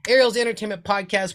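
pumped-up podcast intro; hype intro. Acted. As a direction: hype up the show opening.

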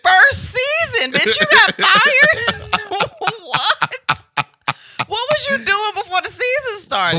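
high, laughing taunt; mock her through laughter; laughing mockery. Mocking the fired realtor, laughing.

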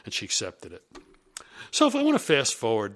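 ordinary delivery

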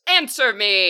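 a spirit demanding a reply at full voice; demanding water spirit. Acted. Water spirit to the waiting adventurers, loud and demanding. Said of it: "She goes".

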